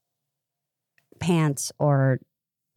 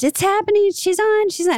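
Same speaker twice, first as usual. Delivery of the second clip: mock excited squeal, much higher; excited screaming. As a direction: shriek that the show is on, hammed up. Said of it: "you all screamed".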